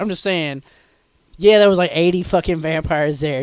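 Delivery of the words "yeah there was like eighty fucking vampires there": loud, exasperated outburst. Real, not acted. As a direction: shout it out, fed up and fuming. Heated complaint about rubber bands.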